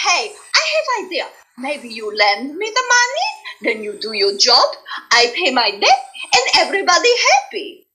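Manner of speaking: put-on high, upbeat Polish accent with a bright idea. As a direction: cheerfully pitch a deal in a Polish accent. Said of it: "she talked like this".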